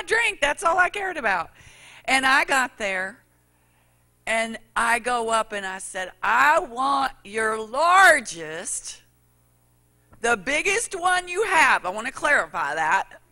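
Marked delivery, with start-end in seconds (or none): 6.2-11.9 loud, slow, insistent demand at the counter; demanding loudly. Retold or imitated. Preacher retelling his order at Burger King, loud and insistent. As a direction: stretch out the order, half shouting.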